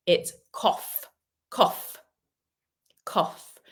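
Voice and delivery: "cough" said as KOFF, GH sounding like F, repeated. Shown as a demonstration. Teacher confirming the F sound to the class, repeating it. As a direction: say "cough" with a clear F at the end. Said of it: "so F".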